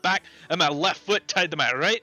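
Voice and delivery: loud, high swaggering dwarf brag; blustering dwarf voice; boasting dwarf. Acted. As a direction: puff up and threaten. Dwarf boasts, threatening fight.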